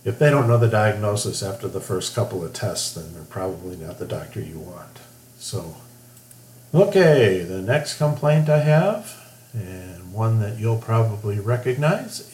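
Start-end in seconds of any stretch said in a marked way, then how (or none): none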